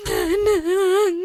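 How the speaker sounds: sobbing call. Acted. Beaten daughter runs to mother.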